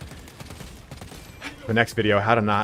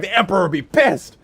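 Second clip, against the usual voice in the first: high, loud, manic outburst; a rider warning the cabin, unhinged; unhinged yell; 9 semitones higher, 5 dB louder than usual. Retold or imitated. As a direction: lose it completely. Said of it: "I immediately get unhinged".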